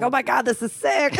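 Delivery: high, quick thrilled outburst; acted excitement. Player as warrior reacts to the hit, excited rush. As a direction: gush it fast and high. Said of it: "And then I'm like".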